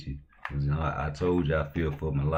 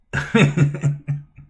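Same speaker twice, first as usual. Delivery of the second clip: bursts into a high, breathless laugh at the date; 11 semitones higher, 7 dB louder than usual. Real, not acted. Streamer laughing at a troll's account date. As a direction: cracks up, laughing high and airy. Reacting to the channel's creation date.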